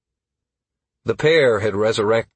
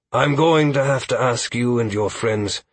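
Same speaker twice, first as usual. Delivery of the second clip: weary leader in a put-on voice; exhausted village leader; 2 semitones higher than usual. Aldwin reporting on two major attacks, weary leader's voice. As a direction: report gravely, falling away on the last word.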